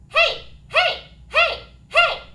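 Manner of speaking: high, pinched whiny heys; whiny mixed heys. Shown as a demonstration. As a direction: think of the whiny kid as you call. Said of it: "create that sensation when saying your heys".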